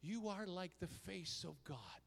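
high, slow, hammed-up declaration; self-mocking melodramatic exclamation; dramatic. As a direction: proclaim it slowly, playing up the awe.

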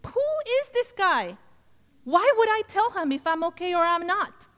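high-pitched, incredulous questioning; indignant inner voice. Retold or imitated. Woman's mind questioning the nosy stranger, indignant. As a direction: act out a mind protesting in disbelief.

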